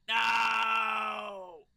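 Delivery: loud, long, high no; desperate shout. Real, not acted. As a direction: scream it out as you lose. Shout as the character dies.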